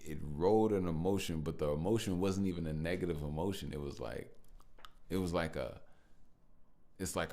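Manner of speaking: ordinary delivery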